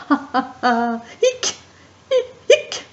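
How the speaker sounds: high laugh broken by hiccups; laugh, then hiccup sharply high. Acted. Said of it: "Et il rit".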